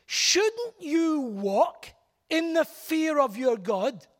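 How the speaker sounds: very slow, "Shouldn't you walk" drawn out; emphasis on theology. Shown as a demonstration. Preacher savouring Nehemiah's question, very slow. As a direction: stretch each word of the question. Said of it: "neat little emphasis on theology".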